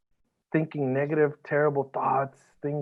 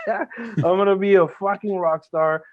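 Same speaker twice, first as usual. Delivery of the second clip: laughing the words out; mocking his younger self, laughing; 4 semitones higher, 4 dB louder than usual. Real, not acted. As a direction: say it through a real laugh. Laughing at his old dream.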